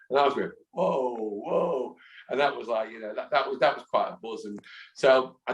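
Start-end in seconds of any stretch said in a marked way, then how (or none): 0.7-2.0 overwhelmed. Retold or imitated. Overwhelmed reaction.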